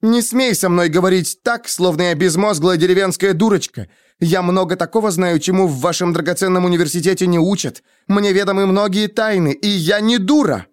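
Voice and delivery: high-pitched indignant tirade; outraged. A narrator's reading. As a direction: defend yourself hotly against an insult. Denna rages in a quarrel. (возмущённая тирада высоким голосом)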